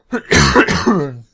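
loud choking cough. Real, not acted. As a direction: hack it out after choking. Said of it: "Ich hab mich verschluckt beim Vorlesen".

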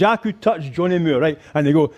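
ordinary delivery

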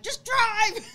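high, loud mock yell; excited retold shout; urgent command. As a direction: order the driver to go, hammed-up. Retold urgent order to driver.

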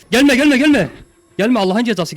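high, loud, repeated pleading; panicked shout. Real, not acted. Player warding off an approaching monster, panicked shout. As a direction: yell to keep it back.

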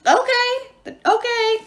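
very high, frantic dwarf voice; high-pitched, panicky giving in; panicked shriek. A narrator's reading. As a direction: shriek in surrender. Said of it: "the dwarf shrieked".

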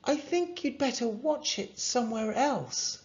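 lifted, regretful parent; apologetic mum. A narrator's reading. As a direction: gently turn the visitors away.

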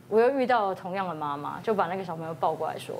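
ordinary talk, nothing marked

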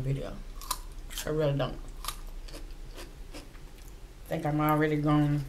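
talking with food in mouth; muffled mid-bite speech; chewing. Real, not acted. Seen 3: bites food at 0.4, chews with hand at mouth.

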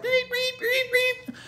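very high, thin alarm beeps; imitated beeping. Preacher mimics the beeping heard from the dryer room, high-pitched. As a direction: squeak it like a little alarm going off. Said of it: "an alarm was going off".

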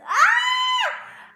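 very high, loud squeal; piercing high cry of emotion; emotional scream. Real, not acted. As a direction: burst into an overwhelmed shriek. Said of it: "I'm gonna cry".

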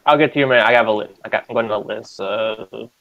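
usual speaking voice

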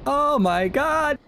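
very high, drawn-out scream; shocked scream. Real, not acted. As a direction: shriek in sudden shock. Sudden enemy attack.

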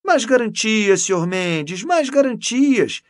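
loud, high emphatic exclamation; exclaiming. A narrator's reading. Canon reassuring Mendes, exclaiming. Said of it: "exclamou o cônego".

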